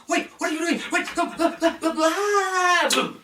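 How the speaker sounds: a man being hauled off, squealing; panicked protest. Acted. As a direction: brother dragged away by the guards, high and panicking. Brother dragged out protesting.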